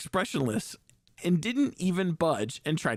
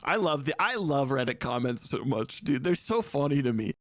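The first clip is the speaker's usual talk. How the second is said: ordinary delivery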